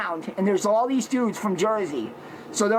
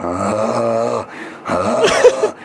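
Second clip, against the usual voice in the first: beast grunts; 13 semitones lower, 6 dB louder than usual. Retold or imitated. Scaring the gang off with loud beastly grunts. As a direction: grunt low, then snap up into a loud snarl. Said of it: "I'm going".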